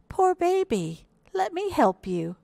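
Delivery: high-pitched, cooing pity; sympathetic little hippo. A narrator's reading. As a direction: offer help in a high little character voice. Hippo comforts stranded baby beetle.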